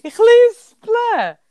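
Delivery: lisple slow and high with a lisped s; drawn-out lisping Ich lisple. Real, not acted. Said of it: "das macht mich fix und fertig".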